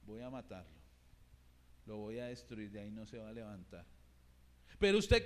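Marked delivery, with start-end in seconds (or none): 0.0-3.9 low, soft, menacing whisper of the devil; Satan's voice. Acted. Preacher voicing Satan threatening a lost son, low menacing murmur. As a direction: drop way down and murmur the threat like the enemy. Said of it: "¿Qué le dice Satanás?".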